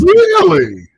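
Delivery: high, loud shocked really; stunned high Really. Real, not acted. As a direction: gasp it out in disbelief. Astonished reply.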